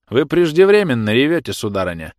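high, loud, scornful officer; disdainful remark. A narrator's reading. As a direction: scold her tears with contempt. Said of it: "брезгливо сморщив лицо, заметил".

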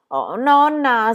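slow, loud pleading wail; despairing. A narrator's reading. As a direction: drag it out in dismay. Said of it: "si disperò Gigi".

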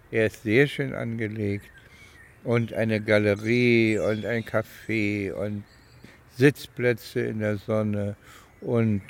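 ordinary talk, nothing marked

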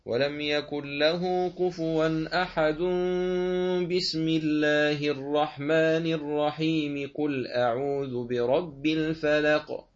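slow chanted recitation carried straight through; recite, linking verse end, basmala and next sura; Quran recitation, all joined. Shown as a demonstration. Teacher demonstrates the wasl al-jami' joining way. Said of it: "هكذا".

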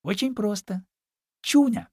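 piglet. A narrator's reading. Piglet cheerfully gives his name.

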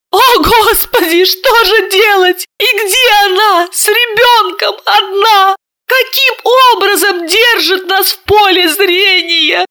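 high, slow crying plea; tearful lament. A narrator's reading. Sister weeping about Marina alone with child, slow and tearful. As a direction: sob out the questions. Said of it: "заплакала Серафима".

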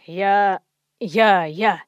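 low braying grunts; donkey bray. A narrator's reading.

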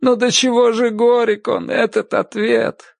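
high, tearful, slowing at the end; blubbering. A narrator's reading. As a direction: sob it out, voice pitched high. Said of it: "захлёбываясь слезами".

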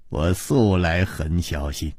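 soft chuckle, low calm reply; smiling. A narrator's reading. Hero assures assassin he is careful, smiling calmly. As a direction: chuckle first, then answer low and unbothered. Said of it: "微笑着说".

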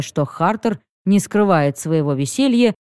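ordinary delivery, nothing marked

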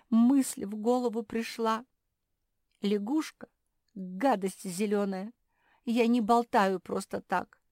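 high, loud, upset self-defence; plaintive, indignant justification; acted sobbing. A narrator's reading. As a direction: protest with wounded insistence. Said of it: "всхлипнула Жози".

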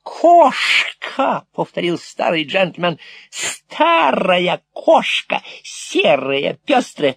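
hammed-up madman shooing a cat; mad old gentleman. A narrator's reading. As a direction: drag out old grey cat, then hiss through teeth. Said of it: "С шипением процедив".

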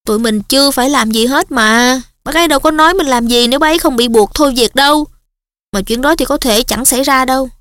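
ordinary delivery, nothing marked

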